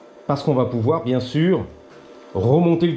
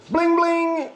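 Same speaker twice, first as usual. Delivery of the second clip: high sing-song. Acted. Shiny part revealed.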